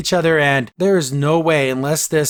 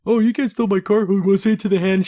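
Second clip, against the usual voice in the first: high, loud whiny protest from a rich guy; outraged rich dude; 6 semitones higher, 4 dB louder than usual. Retold or imitated. Rich dude yelling as his car is stolen, high and loud.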